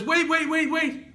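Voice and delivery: high, very loud rapid-fire halt; urgent shout. Acted. God stopping Abraham from killing his son, urgent shouting. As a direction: yell to stop him at the last second.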